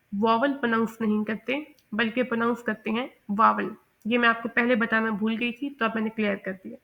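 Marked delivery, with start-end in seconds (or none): none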